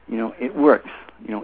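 usual speaking voice

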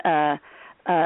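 ordinary delivery

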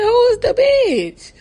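high, drawn-out incredulous question; hammed-up high-pitched outrage; mock incredulous. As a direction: act out stunned disbelief, way up high. Acted incredulous retort to sister.

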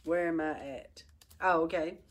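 ordinary delivery

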